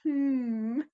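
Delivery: a drawn-out musing hum in place of a gesture; thoughtful hmm. Shown as a demonstration. Actor showing how to voice a thinking gesture, a thoughtful hmm. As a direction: hum it like you're stroking your chin. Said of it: "but you could just be like".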